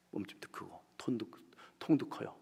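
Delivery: soft confiding whisper about her size; whispered aside. Real, not acted. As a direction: lean in and whisper it. (속삭이듯 귓속말로)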